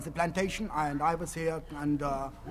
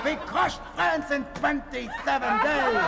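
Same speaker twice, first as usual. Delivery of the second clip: ranting German officer; 6 semitones higher than usual. Pretend. Fake planter slips into a general's boastful rant. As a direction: boast of conquests in a rising frenzy.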